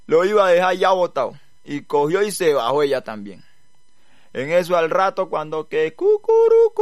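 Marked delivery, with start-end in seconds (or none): 5.3-6.8 high drawn-out cock-a-doodle; rooster's cucurucú, high and long; 1 rooster crow. A narrator's reading. Rooster crows.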